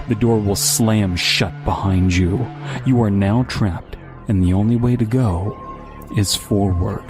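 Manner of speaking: usual speaking voice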